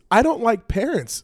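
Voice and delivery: "don't" punched high and loud; stress on DON'T. Real, not acted. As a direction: hit "don't" hard as a punchline. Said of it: "There it is".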